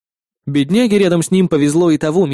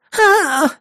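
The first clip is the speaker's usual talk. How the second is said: high pain scream. Acted. Spider pins girl's second arm.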